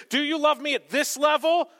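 high, loud, rising question; elevated questioning. Acted. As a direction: pitch it right up and project it. Higher level of love, acted.

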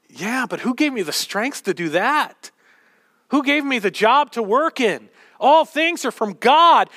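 parent's retort, rising to a shout; building retort. Retold or imitated. Parent answering child, building.

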